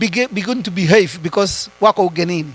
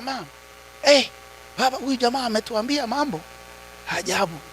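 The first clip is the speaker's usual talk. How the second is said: put-on hushed kids' report; 3 semitones higher, 10 dB quieter than usual. Kids quoted reporting the other dad.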